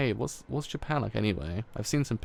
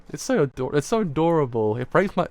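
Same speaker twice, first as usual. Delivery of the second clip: higher and louder, rising to a gush; 5 semitones higher, 7 dB louder than usual. Real, not acted. Reader gushing to viewers about the adorable scene. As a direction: squeal out how much you love it.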